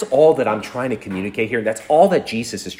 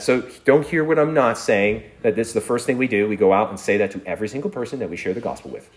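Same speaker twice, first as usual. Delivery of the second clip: normal talking voice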